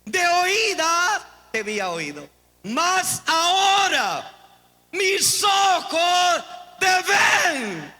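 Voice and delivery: proclamación a gritos, aguda y alargada; emocionado. Real, not acted. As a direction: lanza el versículo con fervor, cada frase sostenida a todo pulmón. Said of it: "como que solo yo me emocioné". (clímax de prédica emocionada)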